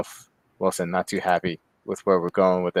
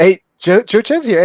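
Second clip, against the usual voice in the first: calling out; 10 semitones higher, 9 dB louder than usual. Real, not acted. Calling out a guest's arrival.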